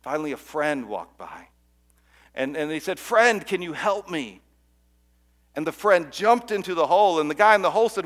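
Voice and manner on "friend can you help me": slow, louder plea upward; slow pleading call. Acted. Man in a hole begs his passing friend, slow and loud.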